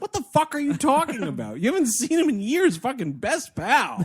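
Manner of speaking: exasperated disbelief. Retold or imitated. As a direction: scoff at the hero like a fed-up viewer. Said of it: "And I was like".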